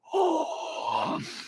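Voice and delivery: high drawn-out gasp. Acted. Host to viewers from Peru, gasping.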